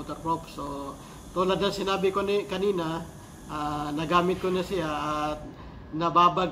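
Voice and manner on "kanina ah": ordinary talk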